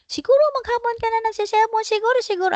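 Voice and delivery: high, fast sarcastic imitation; hammed-up worried grumble; mock nagging voice. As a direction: mimic the sermon you dread hearing. Anxious child's negative thoughts voiced.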